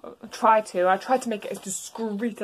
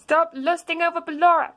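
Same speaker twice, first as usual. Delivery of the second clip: higher, louder scolding mother; scolding mom; 7 semitones higher, 4 dB louder than usual. Acted. Toriel scolding Azzy, high and loud. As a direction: scold him sharply.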